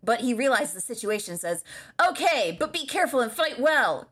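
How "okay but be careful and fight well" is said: higher-pitched cartoon boy; Bart Simpson. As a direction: play the cartoon kid, higher than usual. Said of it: "that's something Bart would say".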